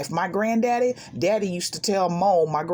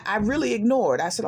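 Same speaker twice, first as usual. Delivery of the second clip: ordinary talk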